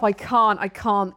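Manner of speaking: gagging while talking; retching between words; dry heaving. Real, not acted. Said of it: "The dry heave".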